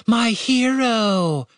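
high, drawn-out mock-adoring call; slow, high-pitched cheer; gleeful shout. A narrator's reading. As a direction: sing out praise to a rescuer. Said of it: "Catherine shouted, clapping her hands".

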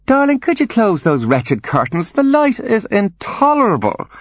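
very high, theatrical complaint; high-strung invalid mother pleading; Angeline, fretful mother. Acted. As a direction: draw out the suffering at the end. Narrator voices Angeline.

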